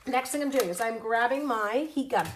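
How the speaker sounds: ordinary delivery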